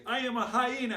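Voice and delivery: high, loud demonstration cry; proud yell. Shown as a demonstration. Storyteller shows kids what to say, proud yell. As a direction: show them the bold hyena yell.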